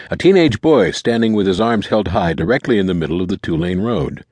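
plain reading voice

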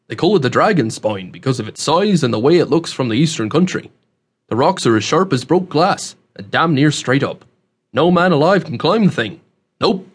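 folksy country dialect, swinging emphasis; rustic old man, explaining. Acted. Host explains the rock cliffs to newcomer, rustic voice. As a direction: explain it like a seasoned backwoods local.

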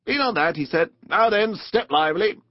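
brisk helper. A narrator's reading. Rat helps Mole step down into boat, brisk. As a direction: urge a passenger aboard.

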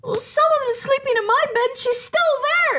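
a cub pointing out the intruder; Baby Bear exclaiming. Acted. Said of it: "exclaimed the Baby Bear".

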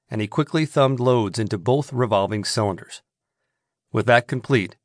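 ordinary delivery

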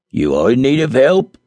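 a dwarf popping up eagerly. Acted. Dwarf appearing suddenly to offer help. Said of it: "said the dwarf".

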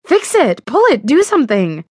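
high, loud frantic commands; frantic pleading. Acted. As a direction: bark them out in panic. Frantic demands about leaf.

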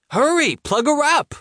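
very high, panicky urging; urgent. Acted. Baby whining, urgent.

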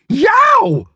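sudden high yelp; surprised yelp. A narrator's reading. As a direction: yelp in shock. Jumps back in surprise.

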